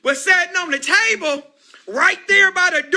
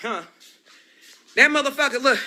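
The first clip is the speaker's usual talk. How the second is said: ordinary talk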